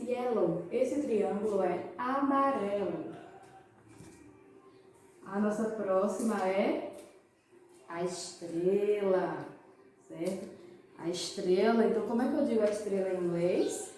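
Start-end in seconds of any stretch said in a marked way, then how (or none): none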